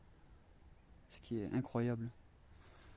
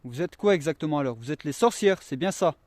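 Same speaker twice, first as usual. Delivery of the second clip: calling out loud; 5 semitones higher, 10 dB louder than usual. Real, not acted. Calling out to spirits.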